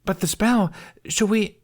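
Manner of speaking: put-on high, loud anxious kid; a child fearing the spell; voiced young boy; worried little boy.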